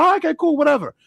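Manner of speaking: high, breezy, dismissive voice; casual brush-off. Retold or imitated. Retelling Dennis meeting the artist, kid shrugs him off, high and breezy. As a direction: toss it off like it's no big deal.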